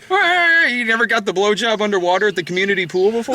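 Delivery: fast, high, teasing question; excited teasing. Real, not acted. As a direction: rattle it off with glee.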